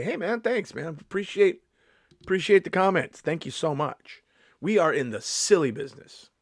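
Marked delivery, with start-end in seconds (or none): 0.0-4.2 higher-pitched, upbeat gratitude; really happy. Acted. Comedian thanking a fan who came up, really happy. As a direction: thank them happily, pitch lifted. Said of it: "I'm gonna be really happy and say".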